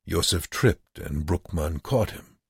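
ordinary delivery, nothing marked

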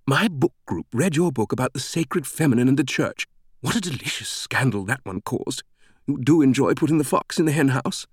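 high, chatty British woman's voice; gushing British fan. A narrator's reading. British reader telling the author about her book group, gushing. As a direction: prattle admiringly, with relish for the scandal.

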